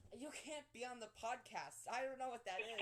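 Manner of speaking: high-pitched mock mom voice; hammed-up scolding mom; friend's mother. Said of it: "uh, your mother".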